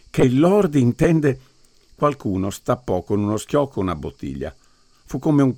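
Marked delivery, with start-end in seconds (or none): 0.1-1.4 high, slow, startled voice; anguished. A narrator's reading. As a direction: gasp it out in alarm. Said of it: "si angosciò Dunn sussultando".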